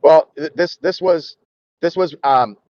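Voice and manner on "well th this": normal talking voice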